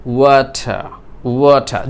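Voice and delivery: British "ওয়াটার" with aspirated t and silent final r, "WAW-tuh"; British accent. Shown as a demonstration. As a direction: say "ওয়াটার" the British way, ending on an open "tha". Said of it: "pronounce this word according to the British accent". (British উচ্চারণে "ওয়াটার", শেষের র ছাড়া "ওয়াঠা")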